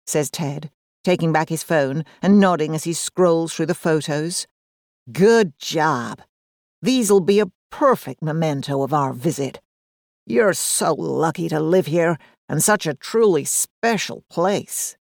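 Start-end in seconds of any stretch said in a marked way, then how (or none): 5.1-15.0 slow, drawling American tourist; American accent. A narrator's reading. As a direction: warm drawling American visitor. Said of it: "in a drawling American accent".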